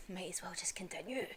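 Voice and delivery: hushed demon whisper; tempting whisper. Retold or imitated. Muzan coaxing Tanjiro toward evil, whispered. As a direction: breathe it like a devil on the shoulder.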